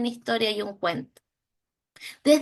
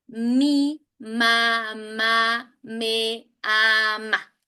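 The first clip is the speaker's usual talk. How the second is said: very slow, word by word; slow reading demo; slow sentence reading; much slower than usual. Shown as a demonstration. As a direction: read the sentence slowly like a first reader. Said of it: "y después a frases".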